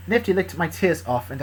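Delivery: ordinary delivery, nothing marked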